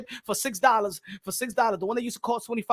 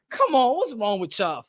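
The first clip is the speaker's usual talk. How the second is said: ordinary talk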